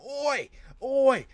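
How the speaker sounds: high, loud cartoon British accent, Oi oi; a cheeky Brit in a mock British accent; hammed-up British accent taunt. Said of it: "he's like".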